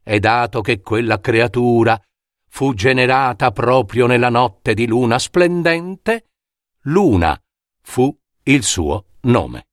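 usual speaking voice

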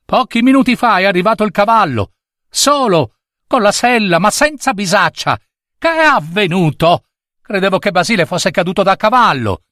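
high-pitched worried woman; anxious old mistress. Acted. Old mistress tells of the horse returning alone, anxious. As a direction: tell it high and agitated.

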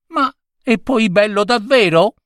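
put-on high, doubtful old woman pressing; insistent old lady. Old lady pressing the gentleman, high and doubtful. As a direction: push him on it. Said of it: "insisté la vecchia signora".

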